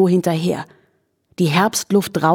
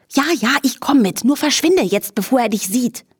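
higher, hasty mare voice; lightly voiced anxious young horse; hurried horse Charlotte; 6 semitones higher than usual. A narrator's reading. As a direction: shoo him off before the trainer looks. Charlotte hurries the flea away.

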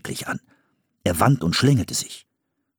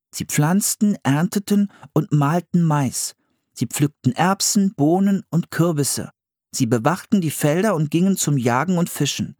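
put-on higher boy voice reading from a book; boy reading aloud; 7 semitones higher than usual. A narrator's reading. Philipp reads the book to his sister, high and careful. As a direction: read it like a kid reciting facts.

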